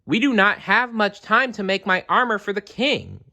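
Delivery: put-on high, keen child's voice; boy planning armor for king; eager boy. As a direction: rush it out like an excited kid. Jerem eager to make armor.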